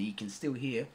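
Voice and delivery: ordinary talk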